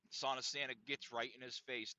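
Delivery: normal talking voice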